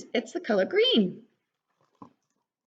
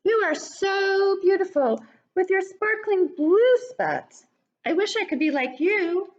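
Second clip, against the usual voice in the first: high, louder voice full of admiration; admiring ladybug; 9 semitones higher, 4 dB louder than usual. Acted. Lucy admiring the caterpillar, high and bright with longing. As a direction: gush over her spots in a high voice. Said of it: "called Lucy".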